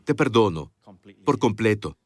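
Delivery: low, tender and deliberate; full forgiveness. Shown as a demonstration. Preacher showing the congregation how to forgive, tender and sincere.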